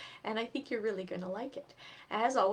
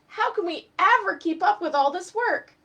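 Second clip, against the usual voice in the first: moaning; 9 semitones higher, 10 dB louder than usual. Acted. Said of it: "Eddie moaned".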